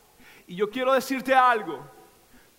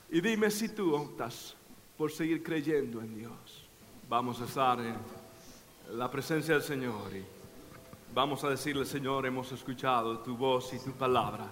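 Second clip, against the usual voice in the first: much softer and lower prayer voice; quiet prayer; 8 semitones lower, 4 dB quieter than usual. Acted. Pastor leading the congregation in prayer, soft and low. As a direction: lead them gently into God's presence.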